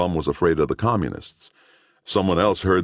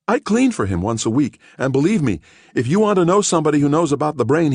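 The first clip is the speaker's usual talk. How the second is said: high, lightly voiced earnest lady; eager cleaning woman; 11 semitones higher, 4 dB louder than usual. Cleaning woman eagerly recommending her doctor to Gerlich. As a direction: gush with helpful eagerness.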